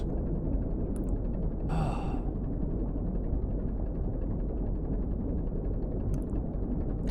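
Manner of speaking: long drawn weary exhale; long sigh. Real, not acted. Player dreading the dripping, long weary sigh. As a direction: let out a long tired breath.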